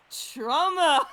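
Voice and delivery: loud comic blurt on the last word; shouted punchline. Real, not acted. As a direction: blurt it out for the laugh. Punchline exclaimed.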